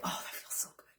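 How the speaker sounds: breathy whisper of pleasure, very soft; whispered bliss. Pretend. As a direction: whisper it like a guilty treat. Acting out the sugar high.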